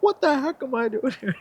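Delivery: high-pitched mock yell, no real volume. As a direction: imitate someone hollering down from up high. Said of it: "yelling down".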